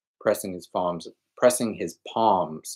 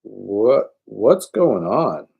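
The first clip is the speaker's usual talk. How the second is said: slow, dazed and scared, a bit louder; confused fear; 4 semitones higher, 7 dB louder, much slower than usual. Acted. Groggy frightened question. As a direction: victim wakes groggy and asks what's happening, dazed fear. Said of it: "eyes widening weakly".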